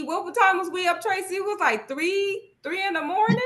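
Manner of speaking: high, playful ribbing of a late-night buddy; playful teasing. Real, not acted. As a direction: tease her about staying up so late.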